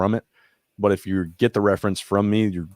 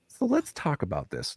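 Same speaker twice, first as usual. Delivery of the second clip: much higher, softer sweet lady voice; gentle old lady; 8 semitones higher, 6 dB quieter than usual. Retold or imitated. Therapist inviting veteran to discuss his drawing, gentle old lady voice. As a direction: invite him softly like a kind grandma. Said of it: "she's like".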